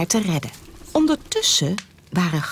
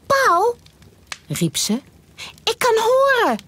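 high, thrilled goose; realising she can hear; high excited goose. Acted.

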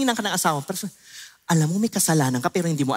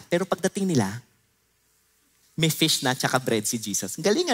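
usual speaking voice

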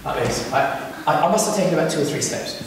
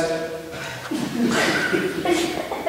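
breathy, voiceless lion noise; soft mock lion sound; imitated lion; 5 semitones higher, 7 dB quieter than usual. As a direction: mimic the lion's noise softly, without voice. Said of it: "the lion literally goes".

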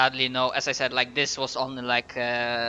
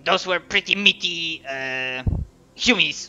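raised, throat-straining goblin; 3 semitones higher, 3 dB louder than usual. Acted. Goblin chatting about human captives, goblin voice. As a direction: talk like a not too bright goblin. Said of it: "doing their voice".

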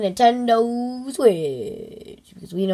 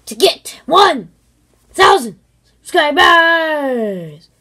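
excited announcement; 5 semitones higher, 7 dB louder, slower than usual. Acted. Hyping the subscriber goal.

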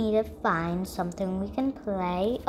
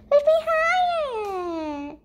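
much higher, slow, stretched plea; eager pleading; slower than usual. Acted. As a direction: beg to be pushed higher, drawing it out. Unicorn begging for a bigger push.

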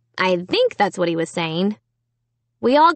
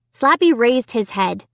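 ordinary delivery, nothing marked

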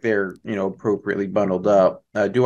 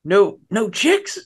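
incredulous; 10 semitones higher than usual. Acted. Sam incredulous in character.

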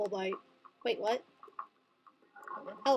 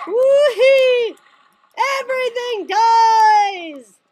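wild cheering; 8 semitones higher, 12 dB louder, much slower than usual. Real, not acted. Shark rampage cheering.

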